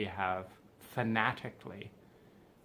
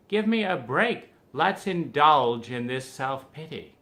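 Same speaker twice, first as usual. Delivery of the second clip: higher and louder, demanding a break and wallowing; hammed-up self-pity demand; 4 semitones higher, 7 dB louder than usual. Friend urging a pity party, loud, mock-demanding. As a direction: throw your arms up and beg to indulge.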